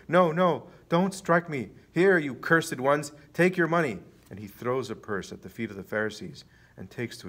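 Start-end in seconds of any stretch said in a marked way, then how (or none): 0.1-4.0 high, loud frightened cries; panicked shouting. A narrator's reading.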